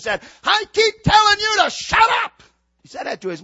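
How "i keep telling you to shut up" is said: high, loud mock tantrum yell; shrieked-out imitation of a furious child; imitated child's scream. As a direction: mimic a little boy screaming at his mother. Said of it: "at the top of his lungs, he screamed".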